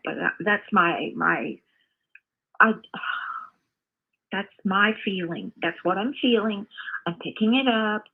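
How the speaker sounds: usual speaking voice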